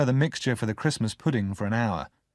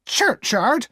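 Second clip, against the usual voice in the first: high, loud incredulous echo; sharp; 14 semitones higher, 6 dB louder than usual. A narrator's reading. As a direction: snap the word back at him. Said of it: "Missus Joe repeated sharply".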